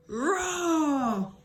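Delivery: drawn-out, hammed-up lion roar; mock roar for the kids. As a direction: give it a playful storybook roar. Lion's loud roar in story.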